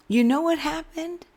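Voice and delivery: rhetorical tease. Real, not acted. Vlogger teasing viewers before the reveal, high-pitched. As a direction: hook them with the question.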